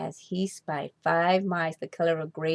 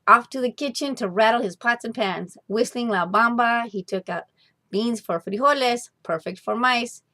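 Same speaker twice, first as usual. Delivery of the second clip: usual speaking voice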